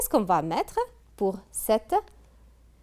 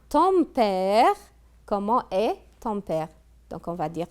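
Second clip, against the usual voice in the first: usual speaking voice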